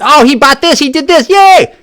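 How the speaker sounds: high, sing-song mock triumph, rising to a squeal on yay; mocking gloat. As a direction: ape their smug cheering. Mocking his opponents' gloating.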